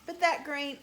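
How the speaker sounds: ordinary delivery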